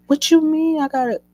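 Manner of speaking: loud, high-pitched indignant retort; indignant outburst. Retold or imitated. Crying patient told by staff to calm down, indignant outburst. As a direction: cut in loudly, voice shooting up in outrage.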